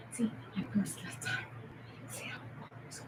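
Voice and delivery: soft unvoiced aside; whispering. Real, not acted. Whispering an aside after cursing. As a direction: breathe it out under your breath.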